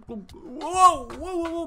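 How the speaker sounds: loud high alarmed whoa. Real, not acted. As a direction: cry out in alarm. Alarmed at falling.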